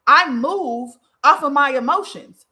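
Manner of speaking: loud, slow, mocking quote of a defensive client. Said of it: "They would say".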